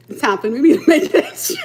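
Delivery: giggling sung-speech line; laughing quote. Pretend. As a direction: quote voiced high through laughter. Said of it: "You gonna say".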